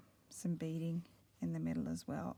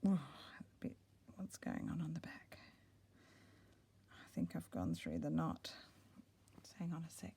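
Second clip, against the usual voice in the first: whispering; 2 semitones lower, 7 dB quieter than usual. Real, not acted. Quietly checking the stitching.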